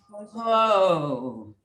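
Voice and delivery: loud, open "whoa" falling away; voice exercise whoa. Shown as a demonstration. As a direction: let a full "whoa" pour out. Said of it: "let's hear a little bit".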